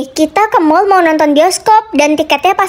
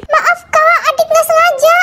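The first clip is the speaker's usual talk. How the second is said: put-on much higher, childlike apology with a giggle; little kid voice; 9 semitones higher than usual. Little sibling apologizes to angry big sister, high giggly kid voice. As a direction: squeak it out like a small kid caught.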